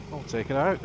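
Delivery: ordinary talk, nothing marked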